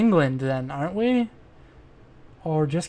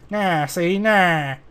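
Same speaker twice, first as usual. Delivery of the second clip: drawn-out, whiny stock-character "nah"; put-on character voice; 2 semitones higher, much slower than usual. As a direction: play a stock tough guy, stretching each "nah". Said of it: "That's more of like".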